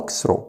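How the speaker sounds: "walk through" said with no L in "walk" and no GH sound in "through"; silent letters. Shown as a demonstration. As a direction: say "wok thru", dropping the silent L and GH. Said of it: "ال A هنا silent وال جي اتش silent". ("walk through" بدون نطق ال L وال GH)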